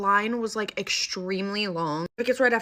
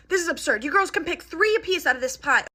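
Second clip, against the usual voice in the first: exasperated mom; 7 semitones higher than usual. Retold or imitated. Exasperated mom scolding the girls.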